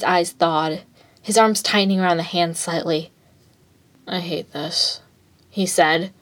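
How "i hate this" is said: soft, lifeless delivery; drained and dead. A narrator's reading. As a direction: say it quietly, all energy gone. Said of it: "his voice sounding drained and dead".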